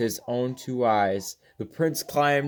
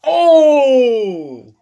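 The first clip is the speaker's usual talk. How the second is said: high, loud shocked gasp; a startled shriek of alarm; panicked cry; 12 semitones higher, 11 dB louder than usual. Acted. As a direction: blurt out in panic.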